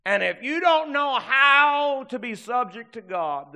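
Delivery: high, loud preacher's challenge; impassioned pulpit exhortation; full-force preaching. Real, not acted. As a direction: swell loudly over the congregation.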